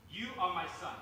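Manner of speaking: ordinary talk, nothing marked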